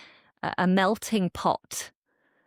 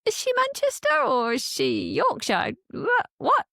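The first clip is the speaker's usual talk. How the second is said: much higher, incredulous hang on a minute; baffled disbelief; 13 semitones higher than usual. Retold or imitated. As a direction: squeak out the confusion of a listener who has been caught out. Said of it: "And you're like".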